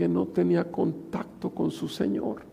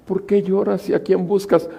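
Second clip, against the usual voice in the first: higher, louder, insistent; loud preaching; 6 semitones higher, 7 dB louder than usual. Acted. Preacher repeating Jesus' question, louder insistent preaching. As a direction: press the question home.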